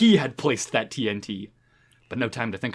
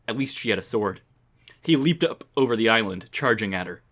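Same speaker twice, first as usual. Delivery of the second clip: ordinary delivery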